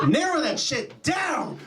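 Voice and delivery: high, slow exasperated yell; exasperated shout. Acted. Comic demands a death date from well-wishers, exasperated shout.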